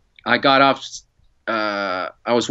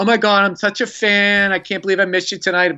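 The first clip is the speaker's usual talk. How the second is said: higher, hurried gushing admirer; mock fangirl excitement, pitched up; imagined gushing fan; 4 semitones higher than usual. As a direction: gush like a starstruck woman. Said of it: "She's gonna be like".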